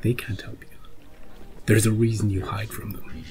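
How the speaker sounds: hushed, menacing Vecna-like voice; confident villain. Retold or imitated. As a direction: taunt her quietly, sure of winning. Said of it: "so confident, so assured".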